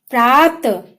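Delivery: normal talking voice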